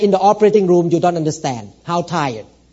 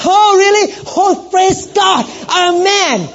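high, loud, slowed praise-God gush; mock excitement; 12 semitones higher, 3 dB louder, slower than usual. Pastor imitating the excitement the offended lady wanted. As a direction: ham up the churchy enthusiasm. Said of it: "You did not get excited enough".

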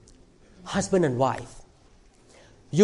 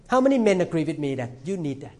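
ordinary talk, nothing marked

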